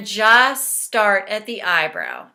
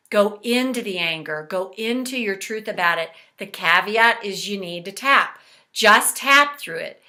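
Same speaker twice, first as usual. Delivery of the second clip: ordinary talk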